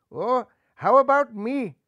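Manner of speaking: put-on high, slow, eager child; small hopeful voice; little bunny voice. A narrator's reading. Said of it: "inquired little Rajban".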